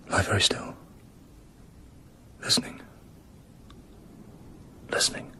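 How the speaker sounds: barely above a whisper, holding his breath; frozen-stiff hush in the dark. Acted. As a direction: breathe the words so nothing hears you. Bond frozen in bed at night.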